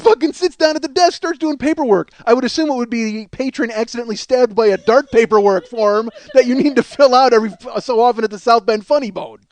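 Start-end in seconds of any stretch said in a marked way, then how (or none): none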